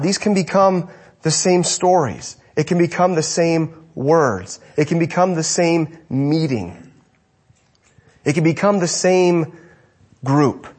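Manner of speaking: slow, weighty repetition; slow emphasis. Acted. Preacher warns the church of routine, slow and weighty. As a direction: lean on each become, slowly. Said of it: "slowed list".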